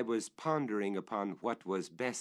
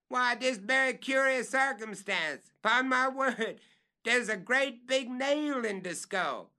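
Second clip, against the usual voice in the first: much higher, louder report; calling down; 6 dB louder than usual. Acted. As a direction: describe the nail from the limb.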